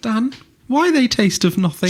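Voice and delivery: high squeaky whine; teasing mock whine; mocking girlfriend voice. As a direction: parrot her in a silly high voice. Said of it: "Mark mimics the girlfriend's whine".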